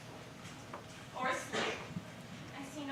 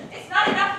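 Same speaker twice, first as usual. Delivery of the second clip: the same phrase pushed louder and higher; angrier louder repeat; 6 semitones higher, 10 dB louder than usual. Acted. Helena rebuking Lysander for mocking her, angrier repeat. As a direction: hit it harder the second time. Helena's anger builds on repetition.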